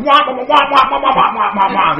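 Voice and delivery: loud, high wah-wah shouting; hammed-up wordless angry preaching; mad pastor. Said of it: "now the pastor's real mad".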